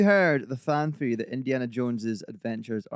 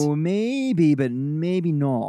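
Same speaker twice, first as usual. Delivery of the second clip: doubtful; 5 semitones higher, 7 dB louder, much slower than usual. Real, not acted. Hedging playfully on a bold claim.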